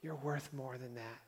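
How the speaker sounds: soft, low, gentle inner voice; small voice. Retold or imitated. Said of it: "the small voice that says".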